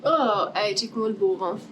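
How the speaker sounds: normal talking voice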